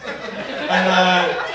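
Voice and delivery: ordinary talk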